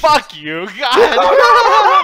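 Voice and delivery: loud, high shout through laughter; helpless laughing yell; laughing outburst. Real, not acted. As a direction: curse while cracking up. Reacts to a joke.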